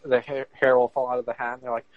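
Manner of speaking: ordinary talk, nothing marked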